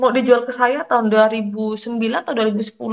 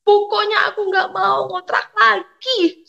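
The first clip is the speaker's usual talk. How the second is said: high, slow wail of refusal; retold tearful protest; 11 semitones higher, 3 dB louder, slower than usual. Fed-up renter protests to God, retold tearful wail. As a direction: draw out the complaint, as if crying. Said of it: "Saya menangis di belakang kosan".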